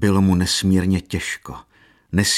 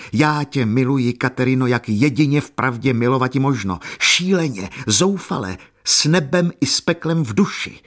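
higher, mounting desperate fervour; passionate declaration; 6 semitones higher, 3 dB louder than usual. A narrator's reading. Jan Maria declaring his love to Katerina, desperate and fervent. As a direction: build the avowal, pitch climbing with each word.

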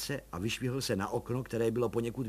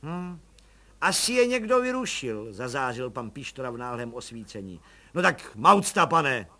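cheeky little policeman; 6 dB louder than usual. Acted. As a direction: play the eager nosy constable. Pištora voice.

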